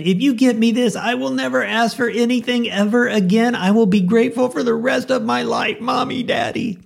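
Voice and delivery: put-on high pleading child's voice, whining at the store; begging little kid. Child begging mom and dad for a toy at the store, high whiny plea. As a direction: beg like a kid who wants the toy. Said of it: "and you said".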